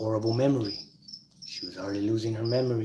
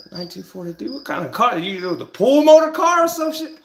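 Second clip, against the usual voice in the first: climbing higher and louder in disbelief; incredulous; 10 semitones higher, 9 dB louder than usual. Retold or imitated. Grandson mocks grandpa's old car, rising loud disbelief. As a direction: build from a high question to a loud squeal.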